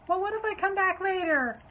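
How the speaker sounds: high, loud whiny voice; hammed-up shrieky bargaining; outraged customer.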